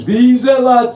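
imitating a Scouse lad calling out from the crowd; lightly acted Scouse heckle; Scouser heckler. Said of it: "some Scouser goes".

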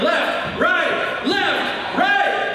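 high, punched marching cadence; drill call. Acted. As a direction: call the steps like a drill sergeant on parade. Calls marching steps in the story.

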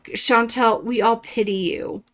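usual speaking voice

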